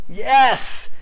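loud, high exclaimed yes; emphatic shout. Real, not acted. Preacher answering his own question, loudly. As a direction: shout it back with full conviction.

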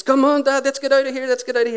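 high, urgent imitation of a worried son; pleading son. Son voiced begging to leave.

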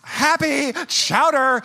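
high, loud, drawn-out fake happiness; mock forced cheer. Soaked husband mocking demand for cheer, fake happy. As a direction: stretch it out in hammed-up cheer. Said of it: "I'm not like a robot".

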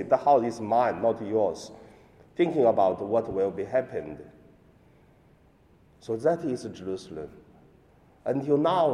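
ordinary talk

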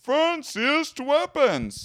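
high, slow booming wizard; game show announcer. Acted. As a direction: boom it like a prize announcer. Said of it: "You sound like a game show announcer".

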